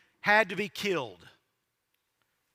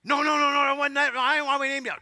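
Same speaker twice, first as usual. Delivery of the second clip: higher, louder rush of denial; hurried mock protest; 6 semitones higher, 4 dB louder, faster than usual. Preacher voicing a new dad defending his son's name, mock protest. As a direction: blurt out a defensive denial, acting it out.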